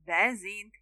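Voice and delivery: usual speaking voice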